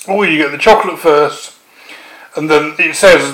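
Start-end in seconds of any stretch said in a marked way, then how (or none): none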